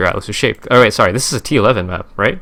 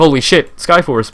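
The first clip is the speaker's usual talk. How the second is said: high, louder mock thrill; excited; 6 semitones higher, 5 dB louder than usual. Player mistaking the boots for Skyforce, excited. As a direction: imitate a player thrilled by a lucky drop. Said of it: "You get really fucking excited".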